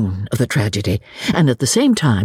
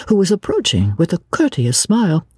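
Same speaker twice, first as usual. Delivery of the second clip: ordinary delivery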